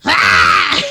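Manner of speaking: loud, low goblin "ah"; goblin screech. Acted. Player imagines a cameo as another goblin, loud low goblin yell. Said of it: "if she ever meets another goblin".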